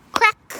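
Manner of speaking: sharp high crack; crack sound effect. Acted. Teacher reading aloud, sharp crack effect. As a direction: snap it out like wood breaking.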